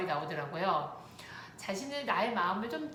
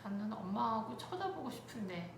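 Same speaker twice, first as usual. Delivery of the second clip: soft, understated longing; quietly voiced inner wish of a child; child's wistful thought; 7 dB quieter than usual. Child sitting in cart wanting mom's look, wistful. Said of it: "아이의 마음은".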